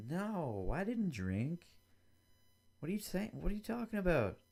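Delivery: defensive liar. Retold or imitated. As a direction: protest too much like a guilty candidate. Candidate lies, denies drinking.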